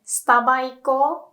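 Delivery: Starba iko as sutaba ikou, evenly sounded; Japanese example phrase. Shown as a demonstration. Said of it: "So I'd say".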